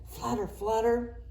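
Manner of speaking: usual speaking voice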